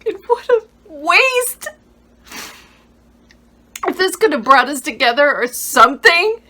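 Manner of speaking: high, loud, tearful outburst; anguished reproach. Acted. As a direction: cry out at the waste. Acted grief-stricken accusation.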